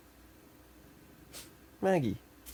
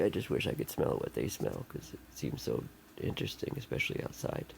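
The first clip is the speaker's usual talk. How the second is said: whispered aside, much softer; 12 semitones lower, 8 dB quieter than usual. Real, not acted. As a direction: breathe it out to yourself. Drops to a whisper.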